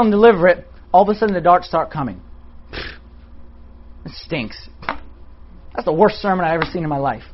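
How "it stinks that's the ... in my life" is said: inner critic voice. Retold or imitated. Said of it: "the darts are saying".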